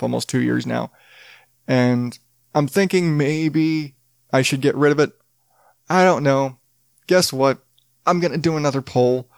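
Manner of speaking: ordinary talk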